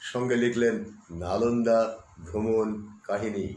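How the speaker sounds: ordinary delivery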